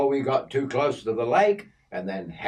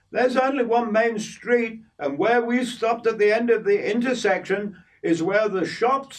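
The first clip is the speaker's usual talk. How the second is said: put-on much higher voice for old Kay; old Kay chiding the boy about hair oil, high voice; voicing Kay; 12 semitones higher, 3 dB louder than usual. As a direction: play the older woman fussing, pitched up. Kay explains the town.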